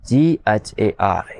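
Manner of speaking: slow, deliberate dictation to pupils; drawn-out spelling for a class; slow letter-by-letter spelling. Shown as a demonstration. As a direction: spell it out slowly, one letter at a time. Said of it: "तो घर की spelling क्या बनी?".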